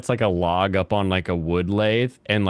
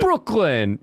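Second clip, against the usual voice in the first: Brooklyn accent; 9 semitones higher than usual. Shown as a demonstration. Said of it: "there you, you got it".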